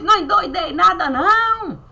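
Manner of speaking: high, loud, fast protest; friar protesting. Retold or imitated. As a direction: insist you are not crazy. (frade protestando, agudo e alto)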